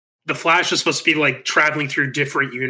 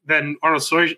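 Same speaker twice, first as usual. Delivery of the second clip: ordinary talk, nothing marked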